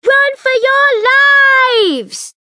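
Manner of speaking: high, loud, slow panicked cry; panicked shout. A narrator's reading. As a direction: scream the warning in terror. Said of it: "Everyone shouted".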